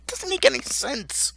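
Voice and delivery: very high-pitched, incredulous complaint; baffled exasperation. Real, not acted. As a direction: let the voice leap up in disbelief. Baffled complaint in film rant.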